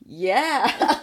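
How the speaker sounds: drawn-out smiling yeah; a spaced-out nodding yes; dazed agreeing. Retold or imitated. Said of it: "smiling and nodding".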